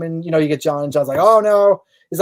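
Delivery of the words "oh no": loud retold oh-no; imitating flustered alarm; caught-out dismay. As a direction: exclaim it like someone just caught late. John retold, caught past deadline.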